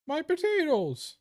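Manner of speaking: very high, drawn-out comic wail; mock cry. Streamer jokes about being purely potatoes, high mock wail. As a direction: cry it out high and slow as a joke. Joke cry in the Irish bit.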